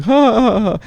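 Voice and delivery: fake laugh. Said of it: "And they were like".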